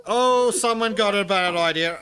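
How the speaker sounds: high, loud and teasing. Real, not acted. Reacting to a yelling cat.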